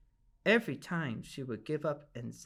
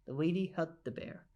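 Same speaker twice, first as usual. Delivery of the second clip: plain reading voice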